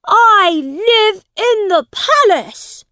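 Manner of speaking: very high, loud princely protest; crying out. A narrator's reading. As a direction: insist at the top of your voice. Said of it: "he cried out".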